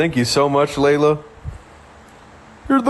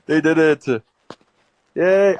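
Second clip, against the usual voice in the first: usual speaking voice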